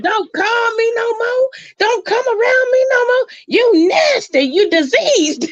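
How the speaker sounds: very high, tearful furious shriek; mad side chick. Retold or imitated. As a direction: shriek it like a woman who just got burned. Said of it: "she said it 'cause she was mad".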